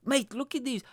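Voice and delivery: high-pitched, incredulous aside to a mate; retold alarm. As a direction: act out alarmed disbelief. Retold panic at Spanish passengers.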